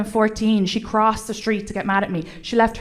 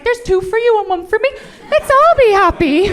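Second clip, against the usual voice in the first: high-pitched mock cheery pharmacist; party-happy pharmacist, high and rising in volume; giddy pharmacist; 10 semitones higher, 6 dB louder than usual. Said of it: "the pharmacist was just like".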